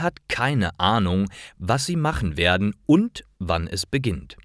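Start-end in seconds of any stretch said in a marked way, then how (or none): none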